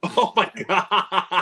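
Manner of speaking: real laughter through the words; drawn-out, helpless laugh; laughing hard. Real, not acted. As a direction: crack up while saying it. Cracking up at the quoted joke.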